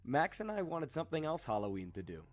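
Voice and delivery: ordinary talk, nothing marked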